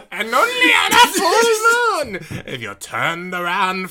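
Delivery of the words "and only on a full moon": high, slow wailing cry; wailing dramatic shout. Pretend. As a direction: wail it like a haunted tale. Spooky legend, crying shout.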